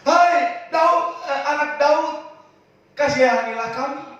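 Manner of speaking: a blind man begging for mercy; crying out for mercy. Acted.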